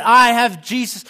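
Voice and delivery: high, loud, jubilant cry; filled with joy. Retold or imitated. Preacher voicing the healed man leaping up and down, joyful. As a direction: shout it like a kid on Christmas morning. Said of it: "filled with joy, just like a kid".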